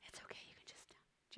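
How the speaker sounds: gentle coaxing whisper. Real, not acted. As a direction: whisper it like a shared secret. Coaxing kids to confess.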